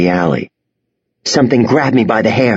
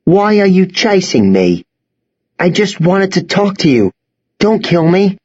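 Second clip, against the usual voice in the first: frightened pleading; 9 semitones higher than usual. A narrator's reading. Boy pleading at knifepoint.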